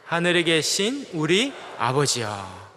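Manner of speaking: slow reciting of the Lord's Prayer; slow prayer recitation; recited prayer. Shown as a demonstration. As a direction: intone it slowly together. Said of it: "첫 구절 같이 해볼까요".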